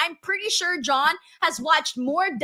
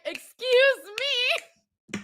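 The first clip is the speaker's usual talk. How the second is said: drawn-out, much higher mock-indignant excuse me; mock offended; 11 semitones higher, much slower than usual. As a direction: stretch excuse me out slow and high like an offended diva. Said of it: "Sheldon's like".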